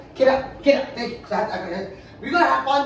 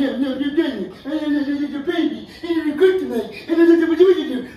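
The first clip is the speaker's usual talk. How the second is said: stretched-out, jeering mimicry that gets louder; mocking his whining; 4 dB louder, much slower than usual. Partner ridicules him for crying over the pregnancy, mocking. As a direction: mimic the complaint as slurred, taunting babble.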